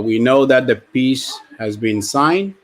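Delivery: ordinary talk, nothing marked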